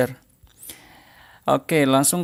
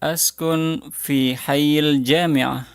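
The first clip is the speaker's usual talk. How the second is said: very slow, deliberate model line with pauses to repeat; slow model phrase; much slower than usual. Shown as a demonstration. Teacher modelling a sentence slowly for students to repeat. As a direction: say it very slowly so the class can follow. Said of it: "ucapkan setelah saya".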